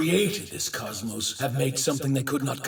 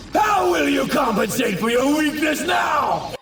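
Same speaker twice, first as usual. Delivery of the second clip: high, forceful battle taunt; shouted taunt; 8 semitones higher, 3 dB louder than usual. Acted. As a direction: challenge the enemy at a shout. Villain god taunts his enemy.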